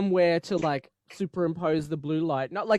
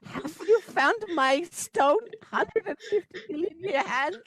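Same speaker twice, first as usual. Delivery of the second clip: [name] impression; 12 semitones higher than usual. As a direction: coo it like [name] playing an alien. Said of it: "It is me, [name]".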